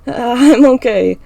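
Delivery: slow, strained after coughing; choked out. A narrator's reading. Emma recovering from choking, slow and strained. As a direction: force it out through a sore throat. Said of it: "I choke out".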